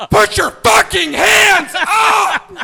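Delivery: very loud, high, slow roared order; furious shout. Retold or imitated. Agent losing patience with the laughing groom, roaring. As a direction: explode at him. Raid order, repeated angrier.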